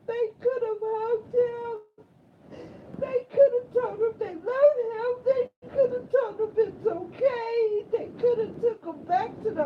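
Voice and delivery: wailing what they should have done; anguished crying. Real, not acted. Crying out against the family who let him sleep.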